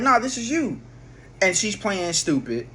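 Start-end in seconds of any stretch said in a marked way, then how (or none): 0.0-0.8 high, sure Nah, this is you; calling her bluff, pitched up; insisting. Retold or imitated. To the girl, high insistent calling her bluff.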